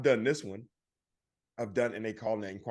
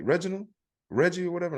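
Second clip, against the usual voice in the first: salesman voice; 8 semitones higher, faster than usual. Retold or imitated. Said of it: "he's like".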